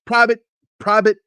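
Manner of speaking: high, loud summons; military holler; imitated drill sergeant call. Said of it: "he said it just like".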